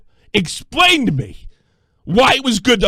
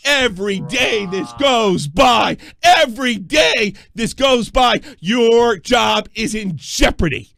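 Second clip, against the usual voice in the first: slow, heavy warning; host warning listeners; slow emphatic; slower than usual. Real, not acted. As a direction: drive each word in.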